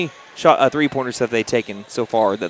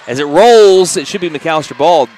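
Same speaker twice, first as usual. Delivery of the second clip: louder, rising over the loose ball; excited; 4 semitones higher, 8 dB louder than usual. Real, not acted. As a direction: pump energy into the scramble.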